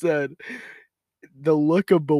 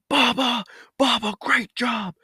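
mock coach yelling across the field, higher; coach calling out; 8 semitones higher than usual. Coach shouting Jacob's nickname on the field, mock yell. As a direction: call the nickname out like a coach on the sidelines.

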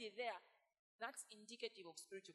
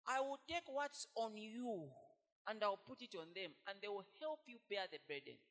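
preaching at full force; 8 dB louder than usual. Real, not acted. Preacher voicing God to the congregation, full-force preaching. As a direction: drive the point home to the church.

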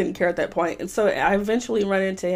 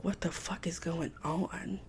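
slow, hushed whisper of disbelief; shocked whisper; 3 semitones lower, 13 dB quieter, slower than usual. Retold or imitated. Shopper whispering as she smells his cologne. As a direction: breathe it out in disbelief. Smelling him before seeing him.

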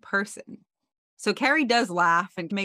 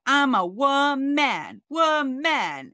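higher, louder mock drunken yell; drunken rant; 9 semitones higher, 5 dB louder, much slower than usual. Carrie drunk-dials her boyfriend, mock ranting loudly. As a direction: draw out each word in a hammy wail. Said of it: "starts ranting and she's like".

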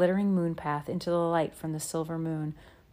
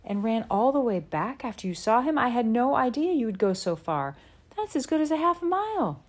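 high, amazed exclamation; surprised; 7 semitones higher, 4 dB louder than usual. Acted. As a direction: marvel at how far. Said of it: "Pa asked in surprise".

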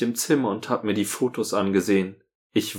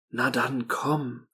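breathy whisper; 3 semitones higher, 6 dB quieter, slower than usual. Acted. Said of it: "hauchte sie ihm ins Ohr".

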